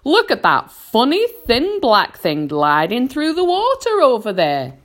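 loud, high-pitched alert; centipede crying out. A narrator's reading. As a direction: call everyone's attention, high and loud. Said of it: "cried the centipede".

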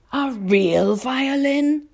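put-on high, slow, drawn-out old grasshopper; astonished cry. A narrator's reading. Old grasshopper cries out to James, astonished. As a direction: stretch the question upward in amazement. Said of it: "the old green grasshopper cried".

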